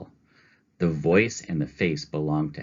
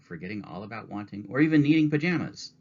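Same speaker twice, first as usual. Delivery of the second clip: ordinary delivery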